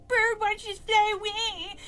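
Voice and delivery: high, loud mocking taunt; sneering bully. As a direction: jeer it like a mean schoolgirl. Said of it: "constantly sneered at me and said".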